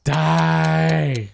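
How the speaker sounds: loud screamed DIE. Acted. Shouted sting in podcast intro.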